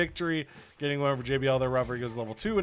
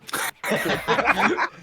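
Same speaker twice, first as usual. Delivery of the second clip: laughing; 7 semitones higher, 4 dB louder than usual. Real, not acted. Laugh after bit.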